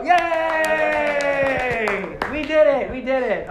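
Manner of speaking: loud, high cheer of triumph; victory cheer. Acted. The narrator celebrates the heroes' win with a loud, high cheer. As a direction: shout it like the heroes just won.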